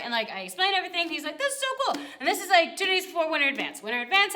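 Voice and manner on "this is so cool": very high-pitched gush; retold high-pitched enthusiasm; delighted reaction. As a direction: coo it high, imitating someone thrilled.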